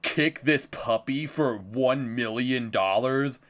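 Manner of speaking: slow, exaggerated dare; stretched-out mock question; mock challenge post. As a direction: parody a social-media challenge, drawing it out. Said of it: "social media challenge posts where it'd be like".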